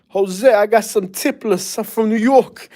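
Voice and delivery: high, drawn-out mock New York accent; Puerto Rican Bronx accent. Mimicking friends twenty years in Florida, in their Bronx New York accent. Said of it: "why do you still talk like that".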